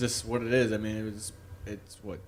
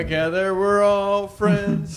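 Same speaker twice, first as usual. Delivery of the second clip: hammed-up, slow; 7 semitones higher, 7 dB louder, much slower than usual. Hammed-up conclusion.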